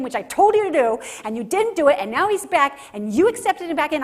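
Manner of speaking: put-on older son shouting his grievance at his father; angry outburst. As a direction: let the resentment burst out.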